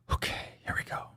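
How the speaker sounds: unvoiced hushed whisper. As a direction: whisper it with suspense. Whispered hype.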